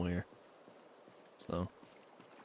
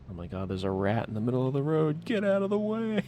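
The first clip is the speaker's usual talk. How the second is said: mock alarmed yell; 8 semitones higher, 5 dB louder than usual. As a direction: build up to a playful high-pitched shout at something in the road. Mock alarm at a rat in road.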